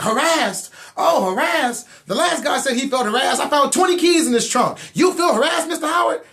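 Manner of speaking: high, loud sarcastic cop shouting; mocking bad cop. Bad cop mocking the suspect, loud sarcastic shout. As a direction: throw the word back at him, jeering loud and high. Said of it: "he was like".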